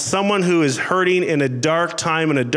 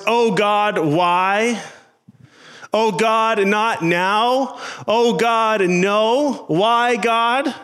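higher-pitched, pleading complaint; complaining to God; 6 semitones higher, much slower than usual. Acted. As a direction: act out a protest in prayer. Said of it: "complain to God".

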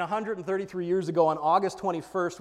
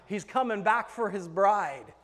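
ordinary talk, nothing marked

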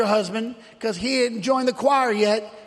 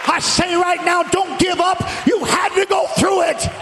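full-force preaching; 4 semitones higher than usual. Real, not acted. Preacher urging the congregation to praise through trials, full-force preaching.

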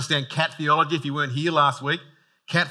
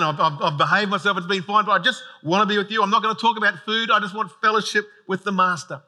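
bright, eager gushing rush of joy; excited dog; 4 semitones higher, 3 dB louder than usual. Acted. Dog greeting its master at the door, eager and gushing. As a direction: gush like a dog overjoyed to see you. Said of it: "it's like".

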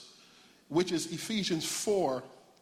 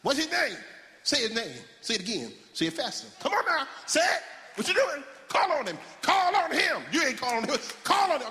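imitated pushy church exhorter, building to high shouts; altar-worker hollering; 7 semitones higher than usual. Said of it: "They make you holler".

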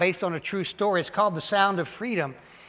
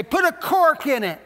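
jokey quip; 7 semitones higher, 5 dB louder than usual. Pretend. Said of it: "a more modern translation could say".

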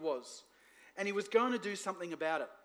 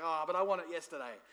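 impatient whining; 2 semitones lower, faster than usual. Retold or imitated. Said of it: "You're like".

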